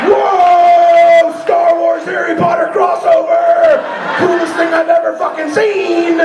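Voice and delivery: very high, loud fanboy yell; over-the-top excited screaming; thrilled shouting. Acted. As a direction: holler in geeky amazement. Acts his thrilled reaction.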